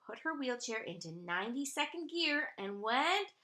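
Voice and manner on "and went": usual speaking voice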